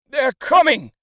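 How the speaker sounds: high, loud warning cry; alarmed shout. A narrator's reading. Horseman riding past warns the crowd, loud shout. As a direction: warn everyone in passing. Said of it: "said a man on horseback".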